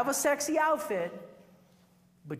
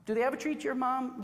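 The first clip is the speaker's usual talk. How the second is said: ordinary talk, nothing marked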